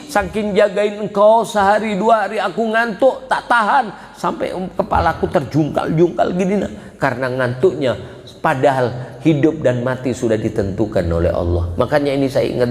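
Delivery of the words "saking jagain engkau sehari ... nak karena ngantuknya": lightly voiced parent pleading to child, high and wavering; a parent telling a child of sleepless nights; preacher voicing a parent speaking to the child; voicing a loving parent.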